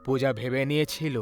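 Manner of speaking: plain reading voice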